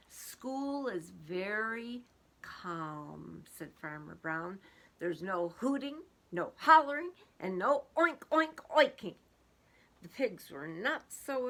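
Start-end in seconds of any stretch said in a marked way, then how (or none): 0.1-3.4 very slow calm voice. Acted. Farmer models calm. 5.0-9.2 louder, firm farmer listing rules; stern list of rules. Acted. Farmer scolding excited cows, firm and loud. Farmer lists what is banned.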